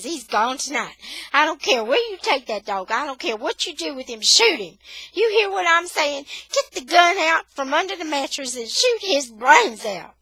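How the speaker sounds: high, loud furious yelling; furious mother. Acted. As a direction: rage at them to get rid of the dog. Mother furious about bitten baby.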